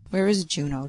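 ordinary delivery, nothing marked

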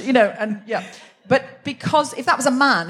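ordinary delivery

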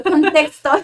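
loud, laughing retold demand; laughing bafflement. Speaker retells her confusion at being applauded, laughing bafflement. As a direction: call it out through a laugh.

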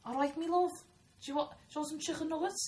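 high-pitched Scouse impression; Scouse accent. Shown as a demonstration. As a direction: put on a lilting Liverpool accent. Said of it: "I was telling Zach the Scouse".

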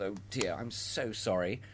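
soft, slow sorry; soft sympathetic Santa; gentle sympathy. Shown as a demonstration. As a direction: say it with genuine sympathy. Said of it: "register what they've said with".

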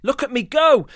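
loud, high joyful cry; gleeful shout. Acted. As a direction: shout for joy while flying. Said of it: "Goose thundered with glee".

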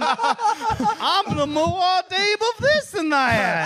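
slow, drawn-out talking toy; a pull-string doll winding down. Pretend. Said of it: "Pull the string again".

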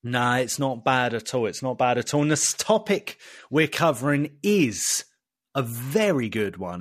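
ordinary talk

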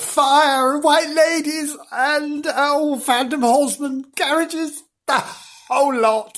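high, gushing showman voice; hammed-up spooky host. Host gushing to listeners over the ghost cast, hammy. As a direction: ham it up like a camp storyteller.

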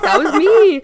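high loud boast; proud boast. Real, not acted. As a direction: brag it proudly.

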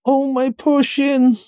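high, drawn-out wail; pained, stretched-out cry; cried out in pain. Acted. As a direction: lament an injury, slowly and high. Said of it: "he cried".

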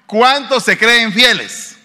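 ¿Cuántos se creen fieles? called out loud, high and slow. Real, not acted. Preacher asking the congregation again, loud and slow. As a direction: rouse the congregation to answer. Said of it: "voy a volver a preguntar".

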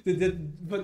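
words pushed out through laughter; laughing. Real, not acted. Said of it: "dieses Lachen noch verkneifen". (lachend gesprochen)

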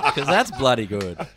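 slow, loud words through laughter; delighted, laugh-filled approval. Real, not acted. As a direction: laugh it out while praising. Laughing at the guest's voice.

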